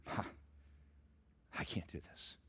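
hushed, breathless whisper; whispered despair. Acted. Moses telling God he can't do it, in a whisper. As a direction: whisper it like a defeated man. Moses' objection replayed softly.

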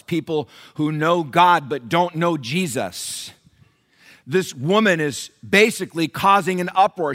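loud, high, climbing pulpit cry; preaching at full force. Acted. Pastor preaching to the church about Paul, full-force sermon. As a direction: build the sermon to a roar. Preaching to congregation, rising fervor.

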